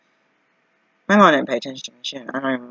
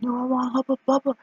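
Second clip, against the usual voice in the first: mock demand; 11 semitones higher than usual. Demanding gum at counter.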